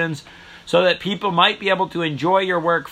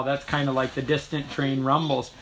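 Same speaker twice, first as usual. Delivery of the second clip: ordinary talk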